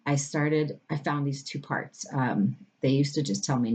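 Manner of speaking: normal talking voice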